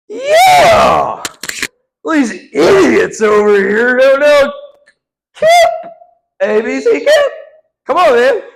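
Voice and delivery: very high, loud crowing; hammed-up shout of scornful triumph; triumphant shouting. Student mocking idiots who don't know Kip, shouted triumph.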